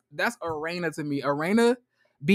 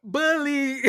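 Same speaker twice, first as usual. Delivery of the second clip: proudly owning the word, drawn out; a higher, mock-proud echo; 5 semitones higher than usual. Imitating Ashley owning the bully label, proudly. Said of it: "She proud as fuck".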